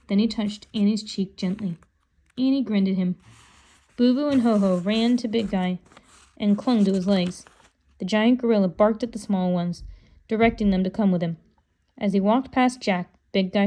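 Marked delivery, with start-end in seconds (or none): none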